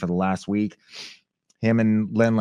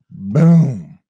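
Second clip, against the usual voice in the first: boom; 3 semitones higher, 11 dB louder than usual. Retold or imitated. Stomp sound effect.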